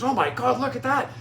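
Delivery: high startled gasp of a line; amazed boss. Retold or imitated. Boss on the golf course spots the eagle, amazed.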